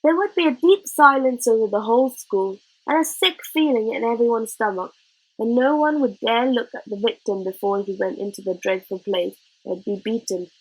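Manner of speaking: usual speaking voice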